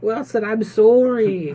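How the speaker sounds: slow, high, put-on Canadian sorry; mock Canadian apology. Friends spoofing a Canadian apology to Wilson, mock Canadian accent. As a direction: apologize to Wilson, way over the top.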